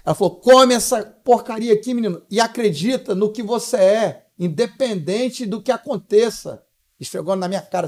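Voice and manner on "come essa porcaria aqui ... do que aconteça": slow, emphatic imitation of mom; forceful command. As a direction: order it like a mother laying down the law. Mother commands son, retold.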